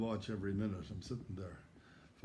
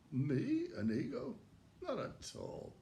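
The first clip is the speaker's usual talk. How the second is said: mock innocence; 9 semitones higher, slower than usual. Mock innocent denial of ego.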